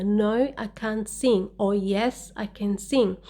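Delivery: ordinary talk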